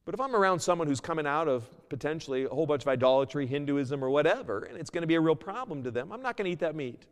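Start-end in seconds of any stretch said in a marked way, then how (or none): none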